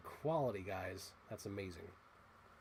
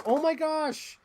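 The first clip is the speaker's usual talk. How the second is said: much higher, loud, slow gasp of delight; excited; 6 dB louder, slower than usual. Real, not acted. Collector spotting a prized toy, much higher and slow. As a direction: stretch it out in delight.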